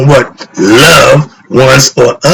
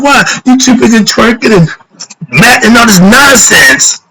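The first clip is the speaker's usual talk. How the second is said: agitated; 8 semitones higher than usual. Real, not acted. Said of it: "Sorry, I'm getting a little agitated".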